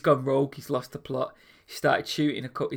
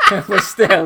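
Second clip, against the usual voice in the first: talking through laughter, louder; laughing; 8 semitones higher, 6 dB louder than usual. Real, not acted. Host tickled by the gorilla story, laughing. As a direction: let the laugh spill into the words.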